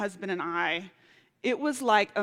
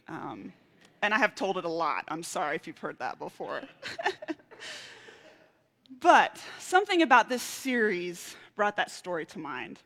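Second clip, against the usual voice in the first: usual speaking voice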